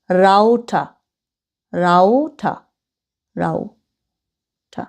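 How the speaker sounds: রাউটা with an au diphthong, the last one slow and fading; modelled রাউটা, au sound; pronunciation drill. Shown as a demonstration. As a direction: repeat রাউটা, slowing down on the last. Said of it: "এইটার উচ্চারণ কি হবে".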